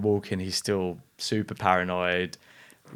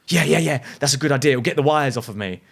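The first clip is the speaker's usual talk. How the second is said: higher, louder eager yeses; manic eager agreement; 5 semitones higher, 5 dB louder than usual. Retold or imitated. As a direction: jump at the idea.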